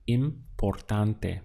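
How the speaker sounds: importante said slowly with every syllable clear; slow model word. Shown as a demonstration. Teacher introducing a new word to learners, slow and careful. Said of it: "To express the word important".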